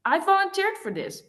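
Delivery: high, sharp snap back; snapped back. A narrator's reading. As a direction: fire it straight back at him. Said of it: "she snapped back".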